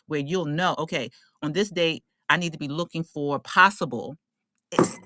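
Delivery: ordinary talk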